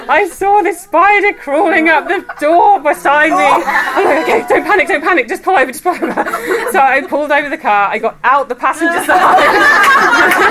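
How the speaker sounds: high, loud, laughing retelling; laughing panic. Real, not acted. Speaker retelling the spider in the car, laughing high and loud. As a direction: tell it through giggles, high and loud.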